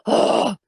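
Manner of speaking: loud breathy scoff of disgust; disgusted ugh. Retold or imitated. Angry customer reacting to the manager, exasperated huff. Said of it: "she just makes that noise".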